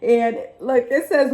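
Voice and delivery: normal talking voice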